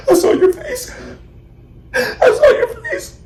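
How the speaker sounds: very high, squeaky sobbed words; hammed-up tearful squeal; friend crying. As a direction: imitate a friend bawling through the words. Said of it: "crying, like, water gushing out".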